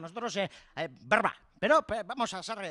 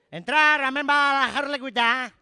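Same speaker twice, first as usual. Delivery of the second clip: calling out; 4 semitones higher, 7 dB louder, slower than usual. Real, not acted. Calling someone onto stage.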